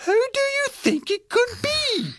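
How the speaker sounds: very high-pitched mother bear; wondering question in a high bear-mama voice; Mama Bear asking. A narrator's reading. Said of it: "asked Mama Bear".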